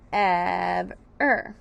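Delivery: "Ev-er" loud and low, in two held beats; syllable by syllable. Shown as a demonstration. Teacher repeats the first syllables, stretched and separated.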